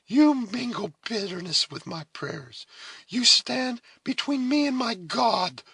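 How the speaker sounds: high, loud, solemn reproach; solemn rebuke. A narrator's reading. Cora accusing the Huron amid her prayers, solemn rebuke. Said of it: "with a solemnity".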